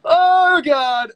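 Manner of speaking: high, loud comic wail; dramatic dread. Real, not acted. Streamer asked for a British accent, dramatic wail. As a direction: wail it as if put on the spot.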